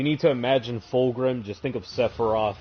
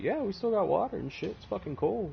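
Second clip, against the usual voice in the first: pitched up, quick, soft boast; higher, softer mock-casual brag; 9 semitones higher, 5 dB quieter than usual. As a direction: brag lightly, pretending it's nothing. Horus bragging about water.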